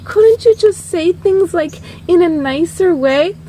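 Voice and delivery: high, emotional mock plea; wounded singer's appeal; hurt pleading. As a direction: plead with the harsh producer to be kinder.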